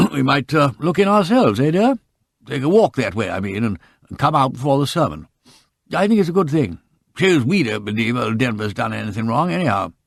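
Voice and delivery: hearty military agreement; hearty Colonel. A narrator's reading. The Colonel speaks on.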